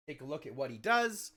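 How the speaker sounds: normal talking voice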